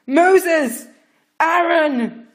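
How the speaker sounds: loud, high scream. A narrator's reading. Pharaoh screaming for Moses and Aaron, scream. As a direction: scream their names over the wailing. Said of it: "Pharaoh screamed".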